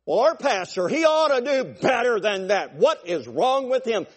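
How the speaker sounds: high, loud mock complaint; griping church member. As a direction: whine up high about the pastor.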